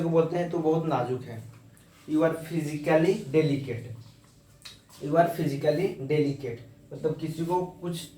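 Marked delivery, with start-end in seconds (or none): 2.1-6.6 each word spaced out slowly for students; slow, deliberate teacher dictation. Shown as a demonstration. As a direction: dictate it slowly so they can write it down. Teacher dictating an example sentence.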